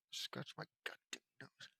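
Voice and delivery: breathless whisper, very soft; whispered aside. Real, not acted. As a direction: mutter to yourself off-mic. Aside to self.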